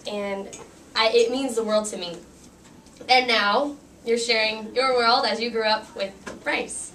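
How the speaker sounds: normal talking voice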